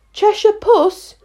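high, slow, tentative address; timid Alice. A narrator's reading. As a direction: call out tentatively, pitched well up. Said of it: "she began rather timidly".

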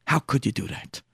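soft, wounded accusation; hushed reproach. Acted. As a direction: drop the voice in hurt disbelief. Son confronts father, drops his voice.

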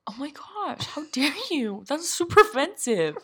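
high, fake offended; mock outrage. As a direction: play it hammed-up offended.

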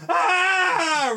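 sudden high, loud yell; character yell. Acted. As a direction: blurt out a shocked scream. Character yells on plane.

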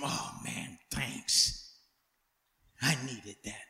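hushed breathy sigh of relief after a cold drink; soft, almost voiceless thanks, low; relieved thanks. Retold or imitated. Thirsty preacher thanking someone for lemonade, hushed relief. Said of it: "hand me a nice tall glass of cold lemonade".

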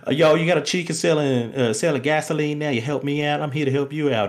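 brash New York swagger, lightly imitated. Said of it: "And then [name]'s like".

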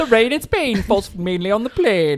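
exaggerated elocution-lesson English accent; mock English accent. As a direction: recite it in a playful fake English accent. Joins in the bad accent joke.

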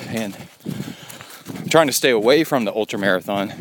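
talking while jogging, breathing hard; while running. Real, not acted. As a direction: speak mid-run on a trail. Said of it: "we're gonna record while I run".

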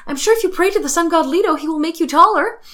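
put-on high, quick teasing girl voice; mock sweet suggestion. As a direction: chirp it brightly and fast like Rose teasing. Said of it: "she goes".

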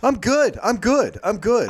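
nervous high-pitched I'm good; squeaky, overly cheerful brush-off; high awkward reassurance. Retold or imitated. Acting awkward reply to therapist.

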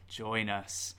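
soft eerie join us; eerie whisper. Acted. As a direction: lure them in, hushed and creepy. Spooky repeated invitation.

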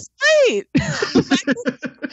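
high, drawn-out laughing protest. Real, not acted. Fan defending the film's humor, laughing. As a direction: burst in laughing, pitch way up.

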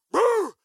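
loud, high mock bark; imitated dog bark. Preacher imitating the dog behind him, loud high bark. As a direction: bark like a big chasing dog.

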